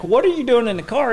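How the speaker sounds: high disbelieving question; startled at the buddy in a stolen car; surprised. Retold or imitated. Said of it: "I'm like".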